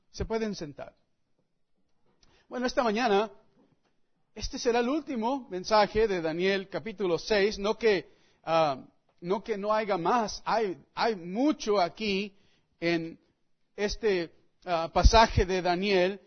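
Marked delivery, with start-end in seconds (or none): none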